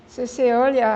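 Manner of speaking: ordinary delivery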